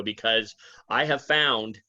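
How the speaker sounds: ordinary talk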